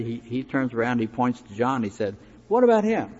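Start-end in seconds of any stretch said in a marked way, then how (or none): 2.5-3.1 high, loud burst of mock indignation; indignant objection. Peter turns and points to John, protesting indignantly. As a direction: act out a protesting disciple, pitch leaping up.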